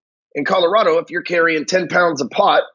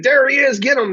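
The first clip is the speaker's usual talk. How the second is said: cops shouting orders; 11 semitones higher than usual. Retold or imitated. Cops grabbing him from his truck, high excited yell. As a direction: bark it like a cop spotting his man. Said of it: "They're like".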